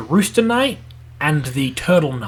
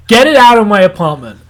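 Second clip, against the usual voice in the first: higher, louder retold complaint; mock indignant demand; 4 semitones higher, 8 dB louder than usual. Waking up to a horse in his apartment, mock indignant. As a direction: imitate an outraged host confronting a guest.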